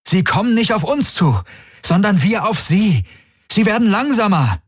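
high, pressing explanation; urgent realization. A narrator's reading. Galen to the crew, explaining the slowdown: high and urgent. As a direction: make them see it fast. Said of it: "rief Galen".

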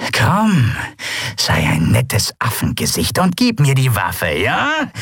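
wheedling menace with a high questioning end; mock-coaxing pirate. Pirate coaxing the boy to surrender the weapon, mock-sweet. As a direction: coax him with a threat underneath.

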